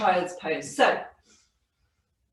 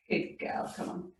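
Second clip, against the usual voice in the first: whispered coaxing; 11 dB quieter than usual. Real, not acted. Quietly coaxing the dog.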